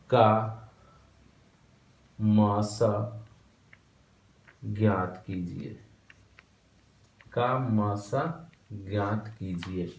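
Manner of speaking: slow, spaced words for copying; slow dictation. Shown as a demonstration. Teacher dictating the example question slowly to students. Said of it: "हम example लेंगे".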